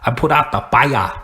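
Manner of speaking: hammed-up mock Irish accent; jokey Irish accent, "fire" as "fai-ah". Shown as a demonstration. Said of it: "Irish is fire".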